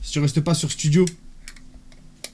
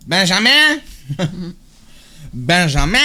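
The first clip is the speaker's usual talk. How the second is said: playful shouting; 2 semitones higher, 5 dB louder, slower than usual. Real, not acted. Streamer yelling a name because they feel like it, playful shout. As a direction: holler the name out for fun. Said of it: "Je crie si je veux".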